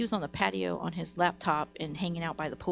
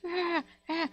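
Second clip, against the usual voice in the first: high-pitched imitation of demon kids screeching; mock kids' screech; 11 semitones higher than usual. Neighbor mimicking the kids next door, high mock screech. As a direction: screech like wild kids, without full volume. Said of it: "these kids are like demons".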